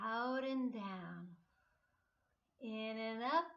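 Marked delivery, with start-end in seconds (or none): 0.0-1.3 slow exhale. Shown as a demonstration. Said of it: "Do it with me".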